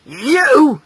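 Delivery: loud fatherly anger; angrily. Acted. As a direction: jab it out as an accusation. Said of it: "said his father angrily".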